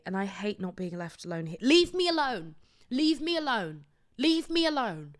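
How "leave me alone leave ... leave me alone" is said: exasperated plea. Real, not acted. Ranting repeated plea.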